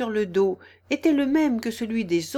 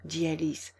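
usual speaking voice

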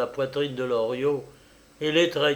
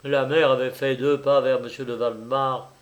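usual speaking voice

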